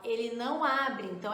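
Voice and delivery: ordinary talk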